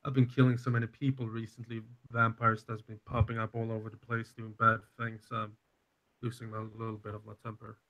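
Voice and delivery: soft, sheepish confession; subdued apology. Acted. Apologising to the man of the house for temper, subdued.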